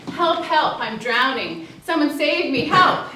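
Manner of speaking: a drowning stranger shouting from the water; mock cry for help. Telling a parable, imitates the second drowning person's mock yell.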